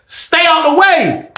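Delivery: high, long drawn-out holler; called-out catchphrase. Real, not acted. Vlogger signing off to viewers, high drawn-out call. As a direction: stretch every word and sing it out high.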